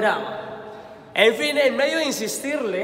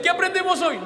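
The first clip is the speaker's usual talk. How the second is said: mock shout, much higher; pregunta gritada; 9 semitones higher than usual. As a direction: call out the question like a parishioner. Imitando a un fiel que pregunta. (pregunta en voz alta y aguda)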